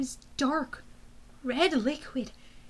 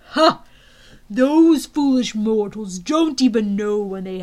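slow, loud gloating villain; booming demon lord; 3 semitones lower, 5 dB louder than usual. Acted. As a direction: gloat over the dragon teeth. General's gloating speech.

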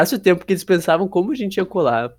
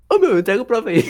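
laughing; 3 dB louder, faster than usual. Retold or imitated. Retold bold call to friend.

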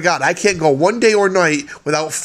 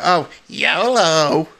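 slow drawn-out mock party girl; lightly mocking slow yolo; mocking imitation; much slower than usual. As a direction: drag out the yolo like a drunk partier. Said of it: "but they just count".

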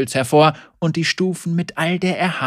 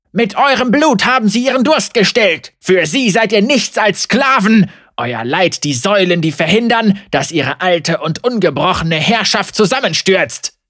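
angry preaching; 6 semitones higher, 8 dB louder than usual. Acted. Heretic rails against rulers.

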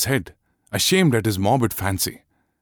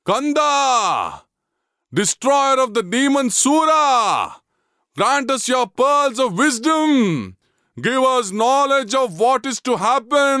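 high, raised ritual pleading; fervent entreaty; 14 semitones higher than usual. A narrator's reading. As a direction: implore the god for answers. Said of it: "he entreated".

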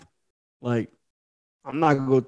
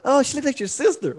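lightly voiced flirty woman; 8 semitones higher, 3 dB quieter than usual.

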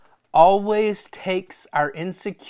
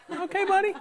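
mocking okay buddy; 14 semitones higher, faster than usual. Friend mocks home church.

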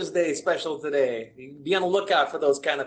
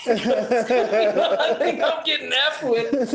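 laughing; 8 semitones higher, 4 dB louder, much slower than usual. Retold or imitated. Retelling the customer, laughing.

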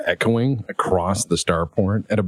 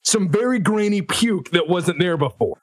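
angry officer; 10 semitones higher than usual. Acted. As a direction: yell it, outraged.

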